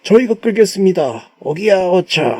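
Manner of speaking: high-pitched acted call to a commander; loyal common folk. Common folk offering to tow the general's ship, hammed-up devotion. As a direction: call up to the general, then heave-ho. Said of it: "민초들이었습니다".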